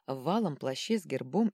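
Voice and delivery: ordinary delivery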